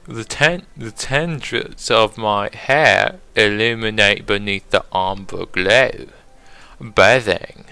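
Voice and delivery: fancy British poetry recital; British accent. Acted. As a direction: read the poem in a put-on British accent. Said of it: "I gotta do it on British accent".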